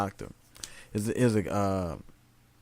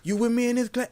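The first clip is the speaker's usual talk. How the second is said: calling out; 11 semitones higher than usual. Retold or imitated. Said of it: "he got everybody named".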